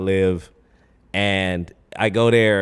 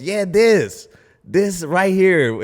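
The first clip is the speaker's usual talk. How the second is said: higher, slow cheering woman's voice; excited lady in crowd; 7 semitones higher, much slower than usual. Retold or imitated. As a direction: call it out like an eager fan, drawn out. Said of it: "Some lady goes".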